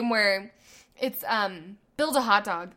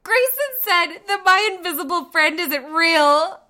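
high, loud tearful complaint; upset little girl; 7 semitones higher, 9 dB louder, slower than usual. Retold or imitated. Daughter runs to mom near tears, high and loud. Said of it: "And she's like".

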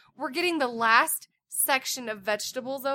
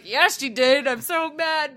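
mock mad; 3 semitones higher, 6 dB louder, slower than usual. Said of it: "part of me was like".

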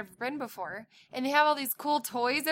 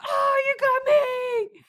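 high, loud playful shout; playful mock cry; 6 dB louder, faster than usual. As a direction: yelp it like bumper cars hit you. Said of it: "then we'd be like".

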